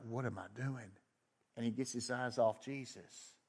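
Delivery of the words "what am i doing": soft, hushed self-doubt; quiet anxious murmur of Peter; worried aside. Retold or imitated. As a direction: mutter it to yourself in fear. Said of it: "he's like".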